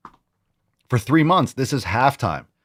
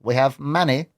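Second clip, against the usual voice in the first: odd, slightly raised tapestry voice; silly put-on voice; 2 semitones higher than usual. Host practising the clip's voice before replaying it. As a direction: try the line in the character's voice. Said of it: "Let's hear it again".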